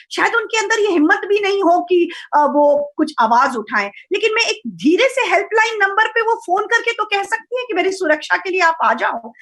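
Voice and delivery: usual speaking voice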